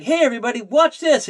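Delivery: high, loud-and-proud call for attention; retold show-off kid calling everyone to watch. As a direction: pitch it way up like a boastful kid. Bart shows off to kids.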